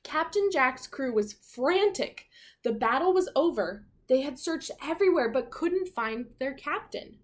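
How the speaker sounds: put-on lively read-aloud for children; animated children's story narration; storybook narration. A narrator's reading. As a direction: read it like a picture book to kids.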